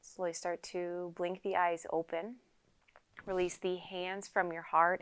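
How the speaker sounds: unhurried yoga teacher leading a quiet meditation; guided meditation. Acted. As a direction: calmly guide them into stillness, leaving space to breathe. Leads a seated guided meditation.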